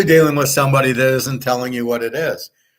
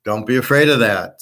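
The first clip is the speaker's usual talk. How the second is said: ordinary delivery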